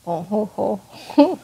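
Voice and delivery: hammed-up Frenchman chortle; mock French laugh. Player teases Noelle as a Frenchman, mock laugh.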